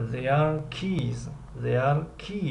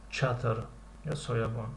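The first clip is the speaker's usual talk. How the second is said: ordinary delivery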